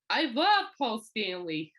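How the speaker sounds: slow, drawn-out mock adoration; hammed-up fan enthusiasm, stretched out; gushing doctor. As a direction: imitate a doctor declaring his fandom. Doctor corrects himself, still gushing.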